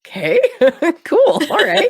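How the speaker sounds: laughing through a breezy okay. Retold or imitated. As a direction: giggle out a carefree cool. Laughing while voicing Lorelai.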